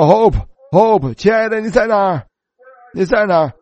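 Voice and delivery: slow, high anguished calling of a name; calling out loud. Retold or imitated. Preacher retelling how he searched a ward full of cots, calling his wife's name loudly. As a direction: cry out for a lost wife across a crowded hall. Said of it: "我开始大声的喊叫".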